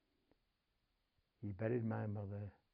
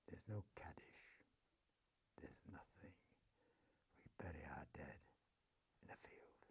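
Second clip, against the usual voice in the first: whisper; 8 semitones lower, 17 dB quieter than usual. Retold or imitated. Woman ends with bleak admission.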